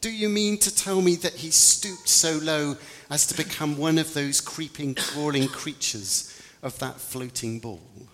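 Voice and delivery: high and scornful, sneering at creeping, crawling creatures; disgusted tone. Acted. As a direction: spit the words out with contempt. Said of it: "in that tone of voice".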